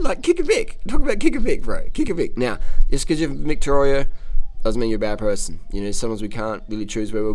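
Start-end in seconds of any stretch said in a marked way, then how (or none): 0.0-2.4 high, loud chant of kick a Vic; cheeky high-pitched jeer; gloating taunt. Real, not acted. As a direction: crow it at the rivals, pitched way up.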